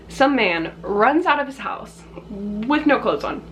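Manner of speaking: ordinary delivery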